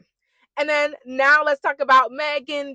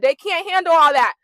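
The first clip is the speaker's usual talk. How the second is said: louder, higher, stressing HANDLE; raised-voice emphatic exclamation; loud emphatic repeat; 6 semitones higher, 7 dB louder than usual. Retold or imitated. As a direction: punch "handle" loud and high.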